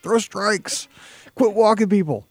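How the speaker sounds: high-pitched mock coaching yell; imitated yelling dad. Dad shouting at his kid pitching, imitated yelling. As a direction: playfully holler like a dad at the ballgame. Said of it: "that was my dad yelling at me".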